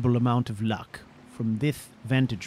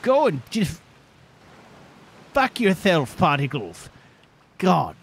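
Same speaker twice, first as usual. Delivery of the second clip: losing his temper; 3 semitones higher, 6 dB louder than usual. Real, not acted. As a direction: let the frustration boil over. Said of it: "I've compromised my composure".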